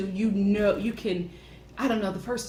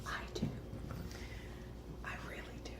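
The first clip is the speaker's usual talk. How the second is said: soft breathy whisper, voiceless; 20 dB quieter, much faster than usual. Real, not acted. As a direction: whisper it like a secret confession. Confessing her crush in whisper.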